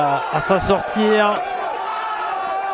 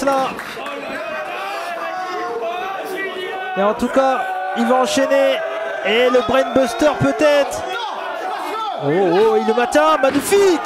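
excited ringside play-by-play; wrestling commentator; 5 semitones higher than usual. Acted. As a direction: call the moves as they land.

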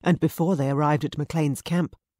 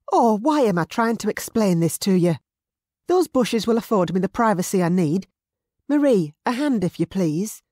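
higher, louder exasperated woman; 5 semitones higher, 5 dB louder than usual. A narrator's reading. Mary exasperated at the guard, higher and louder. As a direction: throw up your hands in frustration. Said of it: "She threw her hands into the".

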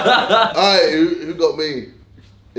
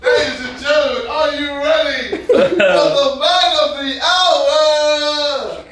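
mock ring announcer; 8 semitones higher than usual. Friend presenting the dressed-up man, mock announcer. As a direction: announce him like the star act, laughing through it.

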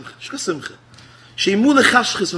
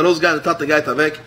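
usual speaking voice

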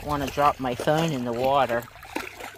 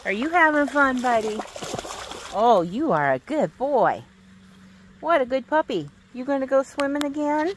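praising the dog; 9 semitones higher than usual. Real, not acted. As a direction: gush over the dog up high.

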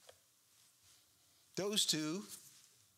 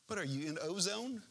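ordinary talk, nothing marked

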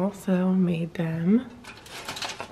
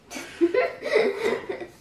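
high laugh. Real, not acted. Laughs at frozen cereal prank.

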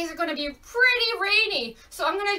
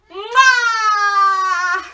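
a loud smacking blown kiss; kiss sound; 4 semitones higher, 9 dB louder than usual. Shown as a demonstration. Teacher blowing a kiss to little ones, loud smack. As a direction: blow a big loud kiss to the kids.

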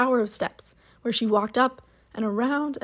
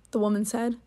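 plain reading voice

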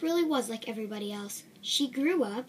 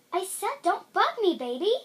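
irritated; 6 semitones higher, 6 dB louder than usual. Acted. Repeated annoyed reply.

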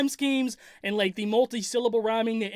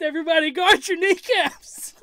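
laughing; 7 semitones higher, 5 dB louder than usual. Real, not acted. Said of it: "make me laugh like that".

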